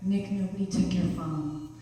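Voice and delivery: soothing a panicked stranger; lightly voiced calm friend; cool as a cucumber. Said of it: "cool as a cucumber".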